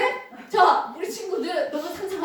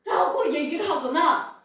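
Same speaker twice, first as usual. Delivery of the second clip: ordinary talk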